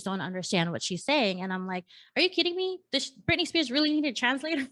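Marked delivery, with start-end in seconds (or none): 2.2-4.7 high-pitched incredulous question; mock disbelief. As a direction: retell your disbelief, pitched up. Quoting own disbelief at others' confusion.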